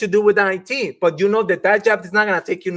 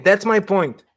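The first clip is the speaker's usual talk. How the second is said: ordinary talk, nothing marked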